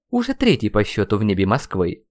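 ordinary delivery, nothing marked

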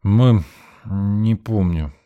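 put-on drunken crying. A narrator's reading. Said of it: "Миша заплакал".

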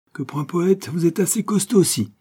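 high, loud call from above. A narrator's reading. Hornet editor calling down the stairs, shouting. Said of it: "lui cria d'en haut le rédacteur".

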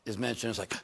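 ordinary delivery

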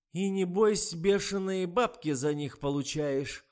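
high, loud, slow scolding; a furious reader scolding, drawn out; angry old woman. A narrator's reading. Angry reader scolds the writer on the phone, high and loud. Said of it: "гневалась тем временем читательница".

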